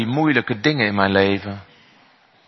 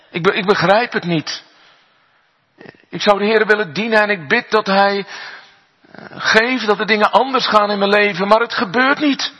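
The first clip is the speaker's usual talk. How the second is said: a hint of a troubled girl, rising higher; lightly voiced girl, pleading more and more; 10 semitones higher than usual. Meisje klaagt tegen dominee. (licht gespeeld meisje, steeds hoger smekend)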